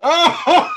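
loud "oh" breaking into high cackling; high burst of laughter. Real, not acted. Co-host hears the project named, bursts out laughing. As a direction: crack up helplessly, high-pitched. Cracks up at the album reveal.

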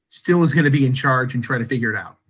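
ordinary talk, nothing marked

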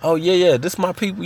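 light it up like you just found a relative; excited recognition. Retold or imitated. Young man meeting a new cousin, excited. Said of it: "Like, huh?".

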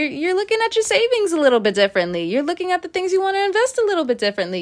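animated. Real, not acted. Tarot reader hyping up her viewers, excited and fast. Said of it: "Why in the world am I talking like this?".

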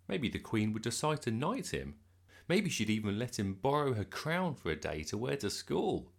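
ordinary delivery, nothing marked

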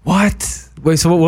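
high, rising Southern-accent disbelief; astonished. Real, not acted. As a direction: blurt it out in real surprise. Astonished at parents lacking the accent.

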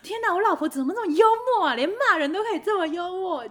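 high, loud mock-adoring husband; delighted husband. Wife imitating her amused husband, gushing high and loud. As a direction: gush like a charmed husband. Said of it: "就说".

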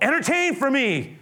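high, loud mocking demand; demanding Herod. Herod ordering Jesus to perform, high and loud. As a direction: taunt him to perform. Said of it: "he's being sort of told".

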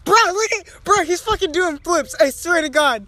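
loud, high excited yelling; excited shouting. Real, not acted. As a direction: yell it out in amazement. Squirrel doing flips.

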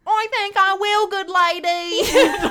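high, loud, drawn-out mock British; hammed-up British, breaking into laughter; bad British accent. Shown as a demonstration. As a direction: botch a British accent loudly. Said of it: "he tries to teach me to do it".

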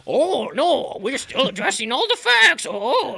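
very high, loud squeaky goblin voice; mocking goblin coach. Mocking Matt Nagy's press answers, high squeaky goblin voice. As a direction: squeal it like a troll under a bridge. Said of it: "he's like the goblin under the bridge".

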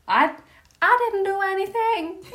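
put-on high innocent child voice; playing a child. Hamlet plays innocent to his mother, high. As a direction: whine it like a kid caught out. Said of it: "He's playing a child".

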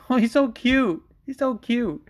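put-on high, cooing baby-talk gush. Fan seeing the newborn at the press conference, cooing. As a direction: coo it like you're melting over a baby. Gushing over fighter's newborn.

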